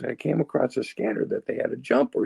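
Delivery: high-pitched storytelling stranger; excited stranger. Retold or imitated. Stranger confessing to the speaker after the meeting, high imitated voice. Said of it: "this is him, not me".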